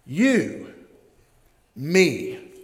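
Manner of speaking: You lifted and stressed, a pause, then me; emphatic you. Shown as a demonstration. Pastor demonstrating the Greek emphasis, stressing You. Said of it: "That's how it is in the Greek".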